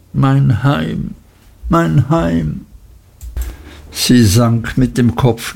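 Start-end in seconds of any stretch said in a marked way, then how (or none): 0.1-1.1 etwas höher, sehnsüchtig; wehmütig. A narrator's reading. Emly klagt um ihr Heim. 1.7-2.7 deutlich höher, fast weinend; brechend klagend. A narrator's reading. Emly klagt um ihr Heim.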